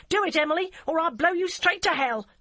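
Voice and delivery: high, loud furious command; furious threat. A narrator's reading. Barbara levels the gun at the DCI, high loud furious. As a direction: threaten her at gunpoint. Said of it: "she cried".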